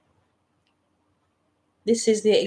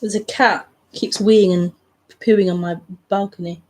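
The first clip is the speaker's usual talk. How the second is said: ordinary talk, nothing marked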